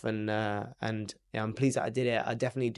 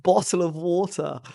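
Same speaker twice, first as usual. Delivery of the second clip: put-on higher, slow parody of an English accent; mock English accent; 6 semitones higher, 4 dB louder than usual. American girls echo his words, mock English accent. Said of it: "like, an English accent".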